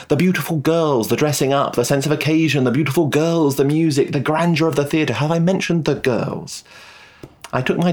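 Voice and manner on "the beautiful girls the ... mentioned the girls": higher-pitched, relishing list of delights; gushing enthusiasm. A narrator's reading. Detective confessing his love of the ballet, gushing. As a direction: rattle off the delights, lifted and keen. Narrator gushes over the ballet.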